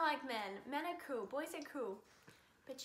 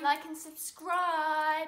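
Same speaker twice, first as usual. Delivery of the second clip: youtuber outro; 3 semitones higher, 9 dB louder, much slower than usual. Acted.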